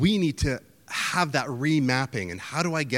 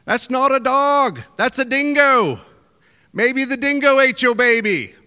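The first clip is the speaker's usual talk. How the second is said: Australian local; 11 semitones higher, 8 dB louder than usual. Retold or imitated. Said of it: "you can just hear".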